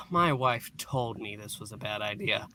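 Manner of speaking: ordinary talk, nothing marked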